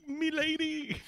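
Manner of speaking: high fop farewell; hammy stage actor. Pretend. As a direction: bid adieu like a dandy.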